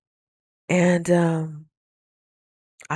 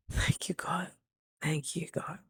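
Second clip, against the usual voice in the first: breathy whisper, far softer, half laughing; whispered thanks; 9 dB quieter than usual. Real, not acted. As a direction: whisper a grateful thank-you to God through a laugh. Laughing quiet thanks to God.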